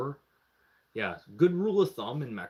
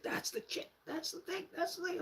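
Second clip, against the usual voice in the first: high, soft half-whispered squeal; squeaky hushed chatter; mock excited whisper; 10 dB quieter than usual. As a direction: imitate friends whispering excitedly as they spy. Friends spying from the balcony.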